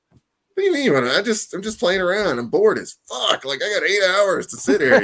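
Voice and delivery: acted high, incredulous protest; high-pitched protest. Security guard answering a man who wants his drawing, high-pitched protest. Acted reply to would-be buyer.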